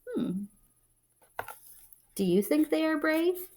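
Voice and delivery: ordinary delivery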